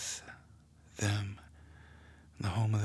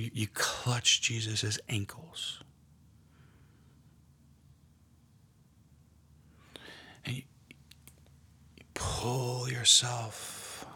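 guided meditation. Acted. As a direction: draw them gently into the scene. Leads a guided prayer meditation.

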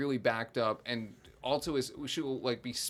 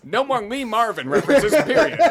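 loud, higher-pitched mock edict; mock stern boss; 8 semitones higher, 11 dB louder than usual. Playing the boss firing the sportscaster over references, loud mock outrage. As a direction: lay down the ban with a hard final "period".

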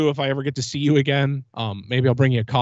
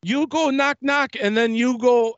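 much higher, slower put-on voice of a stern ride attendant; imitating the lady; 8 semitones higher, slower than usual. Recounting how the attendant at the ride booth called him back, mimicked high and slow. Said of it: "This is how she sounded".

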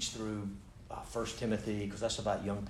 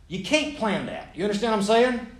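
preaching emphasis; 11 semitones higher, 8 dB louder than usual. Real, not acted. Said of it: "preaching point to congregation".

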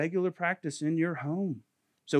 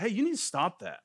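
harsh rebuke; 7 semitones higher than usual. Retold or imitated. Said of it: "that was just harsh".

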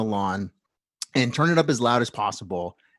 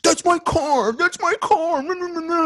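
much higher, whiny wail; whining. Retold or imitated. Neighbor wailing over his wrecked car, high whine. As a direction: whine it like a sulky child. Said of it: "he's just whining like".

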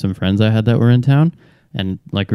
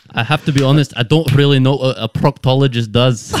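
mock doctor voice; 3 semitones higher than usual. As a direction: play the puzzled doctor with a grin. Improvised doctor scene, patient chat.

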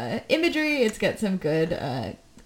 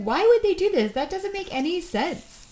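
baffled protest; 8 semitones higher than usual. Retold or imitated. Acts out a baffled viewer's reaction.

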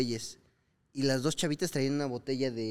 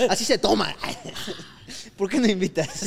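higher, louder, giggly mock tipsy voice; laughing drunk girl; 7 semitones higher, 5 dB louder than usual.

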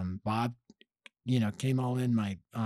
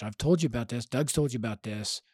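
usual speaking voice

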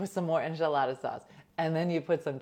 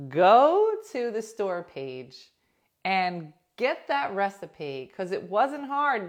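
loud; 4 semitones higher, 9 dB louder, slower than usual. Real, not acted. Urging viewers, loud and slow.

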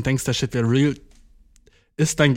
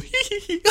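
very high, tittering giggle; mock giggle. Mum laughing off her child's question, mock giggle. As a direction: put on a high-pitched coy giggle. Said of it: "Und sie ist so".

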